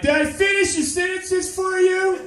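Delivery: high, loud, over-eager helper squeal; imagined autocorrect voice. Acted. Comic imagines autocorrect proudly asking for approval, high over-eager voice. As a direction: squeal it like an eager-to-please sidekick. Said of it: "I just picture autocorrect sounding like".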